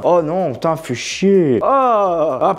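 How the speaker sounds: ordinary talk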